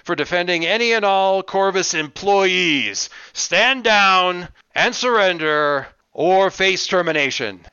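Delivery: loud, slow megaphone warning; bullhorn voice. Acted. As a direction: blare the ultimatum through a bullhorn. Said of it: "the bullhorn voice".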